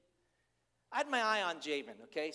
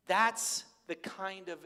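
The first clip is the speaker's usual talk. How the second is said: usual speaking voice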